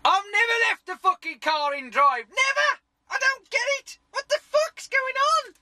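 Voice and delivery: high frustrated yell at your own blunder; exasperated shout. Real, not acted. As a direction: shout it out, fed up with yourself. Exasperated rant about own mistake.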